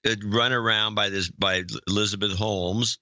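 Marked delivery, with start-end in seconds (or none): none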